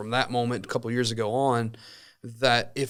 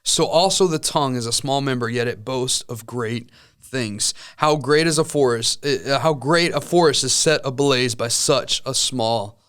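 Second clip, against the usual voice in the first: scripture reading; 2 semitones higher, 3 dB louder than usual. Acted. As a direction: read the passage with weight and care. Reads scripture aloud.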